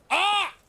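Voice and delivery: high mock yelp of fright; imitated startled yelp. Streamer imitating the companion's yelp at the horse, high. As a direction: squeak like a startled man. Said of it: "looks up and he goes".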